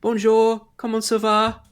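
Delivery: put-on high, bright little-girl French greeting; a child's "Bonjour, comment ça va" in French. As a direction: chirp it like a small child trying out French. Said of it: "and she goes".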